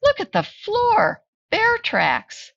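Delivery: put-on very high, excited child voices; children crying out. Children at the door pointing out tracks, high and excited. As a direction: call out like excited kids. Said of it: "the children cried".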